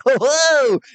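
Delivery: high, drawn-out mock greeting; hammed-up high-pitched host impression; Todd Pettengill. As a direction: imitate an over-eager TV host singing out. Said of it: "that was Todd Pettengill".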